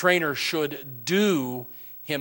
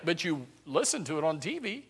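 ordinary talk

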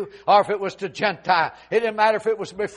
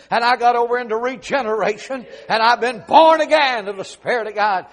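louder climbing testimony; rising preaching; 2 semitones higher, 6 dB louder than usual. Acted. Paul testifying to Festus, preached louder and louder. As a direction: build it up, line by line. Paul's testimony builds to full force.

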